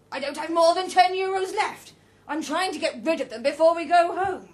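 loud, high argument; indignant protest. A narrator's reading. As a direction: protest at full voice. Roger argues against giving money.

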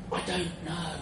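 mock deathbed weakness; dying gasp. A narrator's reading. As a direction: answer feebly with your last breath. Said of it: "I gasped for another breath".

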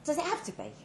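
mild panicked plea; panicking. Acted. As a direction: beg him not to go through with it. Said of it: "I was panicking".

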